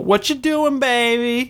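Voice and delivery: put-on high playful What you doing baby; imitating Hiroko. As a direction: lilt it up high and teasing.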